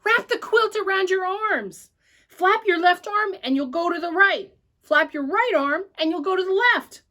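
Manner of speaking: put-on urgent call to a girl aloft. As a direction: shout directions up to her. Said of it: "I called to her".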